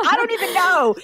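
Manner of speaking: very high, playful clueless self-quote; mock bewilderment. Follower admitting confusion over the kids, acted very high and baffled. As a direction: throw it up high in comic confusion. Repeats own puzzled reaction.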